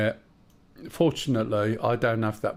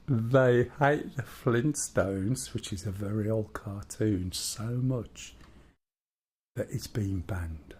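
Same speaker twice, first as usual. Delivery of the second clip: storyteller voice; 3 dB quieter, slower than usual. Acted. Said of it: "his proper storyteller voice".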